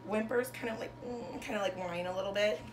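lilting picture-book read-aloud, bigger on each Llama Llama; reading to children. Acted. Teacher reading a rhyming bedtime book to her class. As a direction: read it like a bedtime story for little kids.

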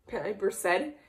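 plain reading voice

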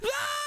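very high-pitched yell; falling scream. Acted. Child jumping off the balcony, falling scream.

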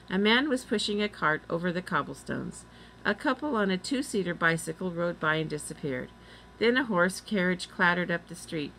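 plain reading voice

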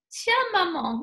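high and bright "tiens, maman"; eager little rabbit. Acted. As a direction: offer it like an eager child. Rabbit gives flowers to mummy.

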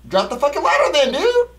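exasperated high shout; frustrated yelling. Real, not acted. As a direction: yell at your teammate in frustration. Yelling at partner over ladder.

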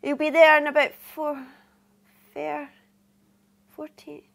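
loud broad Scottish sign-off; cheery Scottish dispatcher ending the call; broad scottish accent. Acted. Phone booking, trails off, loud goodbye.

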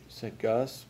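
usual speaking voice

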